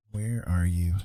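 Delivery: normal talking voice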